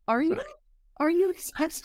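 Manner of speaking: doubtful high question; mock disbelieving are you excited; sceptical. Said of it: "And I'm like".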